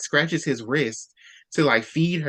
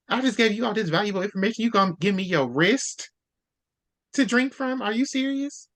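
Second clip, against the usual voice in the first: higher-pitched, indignant questioning; indignant disbelief; 6 semitones higher than usual. Acted. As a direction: act out affronted disbelief, voice climbing. Acted vampire's indignant reply.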